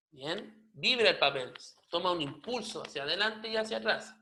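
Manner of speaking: ordinary talk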